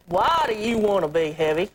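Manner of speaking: low-pitched imitation of a blunt TV counselor; imitated low, challenging question; Doctor Phil. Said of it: "you said".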